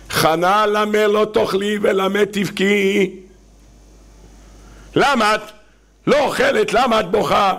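coaxing his wife, a little drawn out; pleading husband. Acted. Husband Elkanah pleading with Hannah. (בעל מתחנן לאשתו בקול מעט גבוה יותר)

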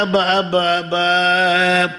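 drawn-out, half-sung cry to father; mock-weeping singsong; tearful calling. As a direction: imitate a son wailing for his father in a chant. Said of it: "בוכה".

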